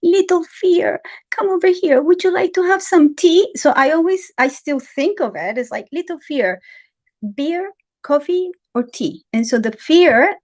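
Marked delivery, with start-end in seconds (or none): 0.0-3.5 high, sweet and gentle invitation; sweet childlike monk. Retold or imitated. Teacher gently inviting fear over for tea, high and sweet. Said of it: "he spoke very sweetly". 5.9-9.2 sweet gentle invitation. Retold or imitated. Speaker inviting her fear to a drink, lightly sweet. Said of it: "he spoke very sweetly".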